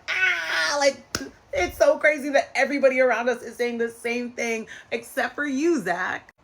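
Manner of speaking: hammed-up teasing of Zach; mock sisters. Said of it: "the two of them were like".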